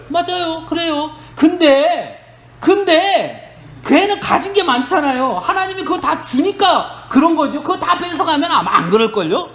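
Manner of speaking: high-pitched, objecting devil's retort. Retold or imitated. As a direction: argue back as Satan, pitched well up. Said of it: "그러니까 사단이 뭐라 그래요?". (높은 목소리로 토를 다는 사단)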